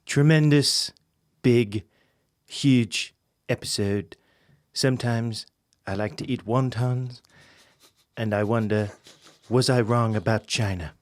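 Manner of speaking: lightly put-on voice, halting between phrases; terrible impression. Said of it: "terrible impression".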